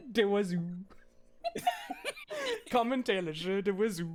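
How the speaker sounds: giggling repeat of the synth phrase; laughing imitation of the TTS. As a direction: echo the robot line while laughing. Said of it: "hear how T T S handles this".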